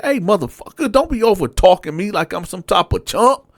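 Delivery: high-pitched heated challenge; angry confrontation. Retold or imitated. [name] jumping out of bed to confront his cellmate, high and heated. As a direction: jump up and confront him. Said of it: "I said".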